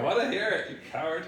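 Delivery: teasing. Real, not acted. Teasing the reader.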